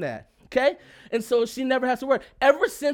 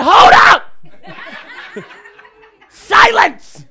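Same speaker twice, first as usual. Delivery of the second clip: much higher, very loud shout with laughter; snapped shout; 9 semitones higher, 14 dB louder, much slower than usual. Retold or imitated. Husband finally snaps at his wife in the car, shouting. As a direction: bark it out, laughing. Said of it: "I snapped".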